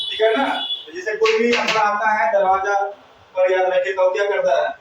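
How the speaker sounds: ordinary delivery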